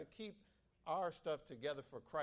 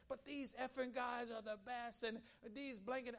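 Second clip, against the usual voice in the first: higher, hyped-up coach voice on effing; gleefully crude coach, pitched up; mock coach swearing; 7 semitones higher than usual. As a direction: ham up the coach cussing in his excitement. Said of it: "then you start saying".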